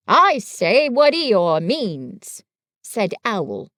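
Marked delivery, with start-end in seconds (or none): 0.1-2.4 loud, slow, swooping owl voice; Owl, pompous. Acted. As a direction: stretch each word out grandly.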